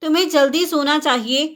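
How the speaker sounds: ordinary talk, nothing marked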